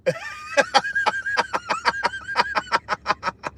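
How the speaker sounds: high, rapid staccato laughter; laughing fit. Real, not acted. Streamer enjoying the joke, long laughing fit. Laughs after saying it feels good.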